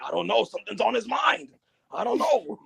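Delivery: lightly hammed-up worry about the boss; imitated worried henchman. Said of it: "And he's like".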